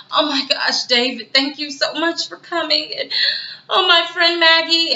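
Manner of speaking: high-pitched mock-tearful thanks; fake grieving gush. Veronica hugging David for coming, fake grieving. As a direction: gush it high, then wail theatrically on the friend's name. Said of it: "it was like an act she was putting on".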